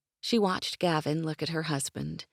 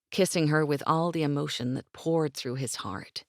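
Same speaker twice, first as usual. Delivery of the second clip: plain reading voice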